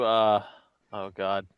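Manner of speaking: ordinary talk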